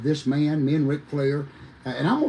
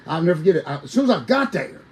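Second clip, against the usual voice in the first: ordinary talk, nothing marked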